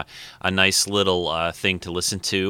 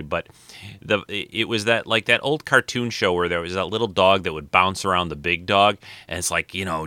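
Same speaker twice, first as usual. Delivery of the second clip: ordinary talk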